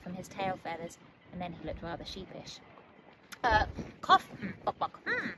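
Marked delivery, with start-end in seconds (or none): 3.4-5.3 loud fake cough and clucks; throat clearing. A narrator's reading. Embarrassed bird covering up, cough and cluck. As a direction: clear your throat sheepishly. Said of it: "as he cleared his throat".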